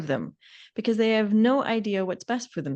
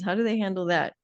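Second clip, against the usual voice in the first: ordinary talk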